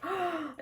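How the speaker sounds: sharp high gasp; excited gasp. Real, not acted. Vlogger thrilled about meeting authors, gasping. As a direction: gasp in delight.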